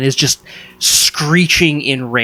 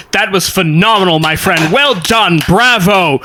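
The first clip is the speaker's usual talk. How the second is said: higher, hearty voice of a cheering onlooker; booming congratulation; 6 semitones higher, 3 dB louder than usual. Acted. Jupiter cheers a duel winner walking away, loud jubilant praise. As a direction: crow your praise for all to hear. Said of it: "Jupiter Jones says".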